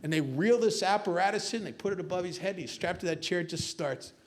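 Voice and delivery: usual speaking voice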